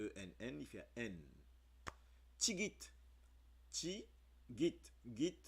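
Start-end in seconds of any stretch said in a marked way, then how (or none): 2.4-4.8 "Tigit" said high and clipped into ti and git; sounding out Tigit. Shown as a demonstration. Teacher to learners: "Tigit" sounded out, higher. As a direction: spell the sound of "Tigit" for the class. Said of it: "Ti, c'est T I".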